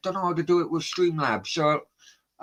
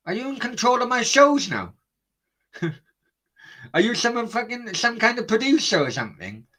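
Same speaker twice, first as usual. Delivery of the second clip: incredulous, higher-pitched jibe at a cheeky viewer; mocking disbelief; 8 semitones higher than usual. Real, not acted. Streamer mocks chatter Dylan.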